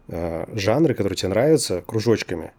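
usual speaking voice

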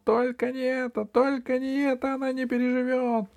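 high, squeaky desperate pleading; shrill wail for the beloved; panicked gnome cry. Acted. Gnome fears for his bride, high panicked wail. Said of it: "закричал".

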